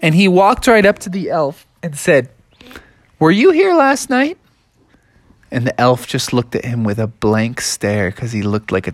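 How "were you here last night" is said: high, curious little frog voice; Froggy squeaks at the elf; small high cartoon frog asking.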